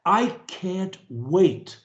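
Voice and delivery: ordinary talk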